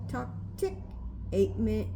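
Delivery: ordinary delivery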